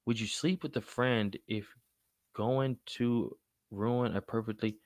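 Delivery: puzzled slow reading. Real, not acted. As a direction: read it slowly, working out the meaning. Rereading a confusing chat question again.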